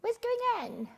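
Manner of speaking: very high squeaky little voice, back in the throat; leprechaun voice. Retold or imitated. Teacher imitates her ninety-five-year-old friend, very high leprechaun squeak. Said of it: "Like this".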